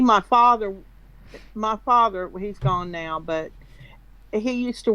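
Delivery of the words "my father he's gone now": ordinary delivery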